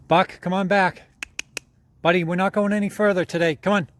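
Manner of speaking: calling the dog from afar; loud call across the woods. Real, not acted.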